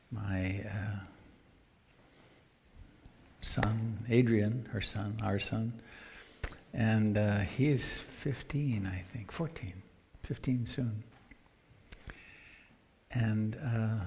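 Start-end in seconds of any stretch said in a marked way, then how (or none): none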